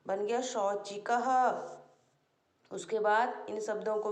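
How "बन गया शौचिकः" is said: usual speaking voice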